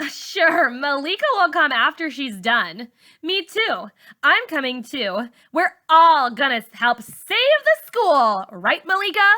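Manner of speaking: high, loud sarcastic jeer; loud mockery. A narrator's reading. Char sarcastically mocking Caleb's plan, loud. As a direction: jeer at them, over the top.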